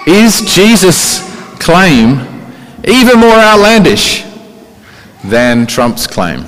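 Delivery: slow, high and stretched, each word weighed; drawn-out rhetorical question. Real, not acted. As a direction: lean hard into outlandish like a challenge. Rhetorical challenge to the congregation.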